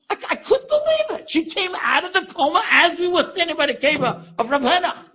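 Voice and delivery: high-pitched, stammering astonishment at the start; amazed. Real, not acted. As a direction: tell the miracle with soaring, excited wonder. Amazed at coma recovery.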